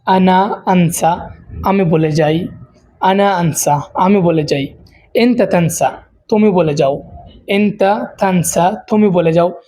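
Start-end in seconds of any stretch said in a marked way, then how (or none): 0.0-1.3 আনা আনসা drawn out slowly, each syllable stretched; slow teacher's demo of আনা আনসা; slow model pronunciation. Shown as a demonstration. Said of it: "তো প্রথম শব্দ হচ্ছে আনসা". 7.5-8.8 এনতা তানসা stretched out slowly, syllable by syllable; slow teacher's repeat of এনতা তানসা; slow model pronunciation. Shown as a demonstration. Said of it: "তো প্রথম শব্দ হচ্ছে আনসা".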